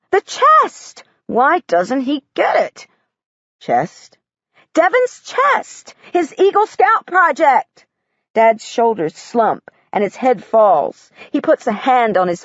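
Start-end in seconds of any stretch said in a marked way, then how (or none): 0.1-7.9 exasperated excitement. Acted. Girl insisting on the chest.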